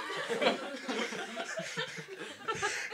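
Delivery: stupid nasal honking laugh; a dumb nasal laugh; nasally laugh. Acted. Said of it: "you'll have to do that stupid nasally laugh".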